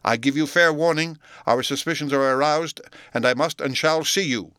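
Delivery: high, forceful ultimatum; loud warning. A narrator's reading. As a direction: call out a stern warning to someone behind a door. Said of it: "cried Utterson with a loud voice".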